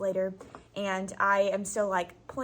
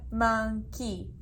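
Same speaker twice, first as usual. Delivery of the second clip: robot voice; 3 dB quieter than usual. Shown as a demonstration. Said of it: "say it like a robot".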